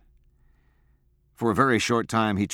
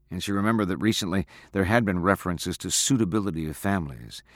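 ordinary delivery

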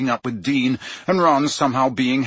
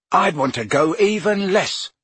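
firm; 8 semitones higher than usual. Acted. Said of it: "said Ron firmly".

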